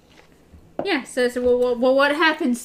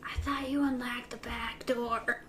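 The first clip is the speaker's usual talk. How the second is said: whispering; 10 dB quieter than usual. Pretend. Whispered joke to the host.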